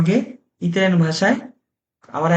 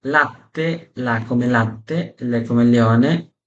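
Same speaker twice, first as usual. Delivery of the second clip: slow, careful teacher demo of each L sound; drilling a beginner one syllable at a time; 3 semitones lower, much slower than usual. Shown as a demonstration. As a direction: spell out each Italian syllable very slowly. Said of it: "come L, teaching syllable sounds".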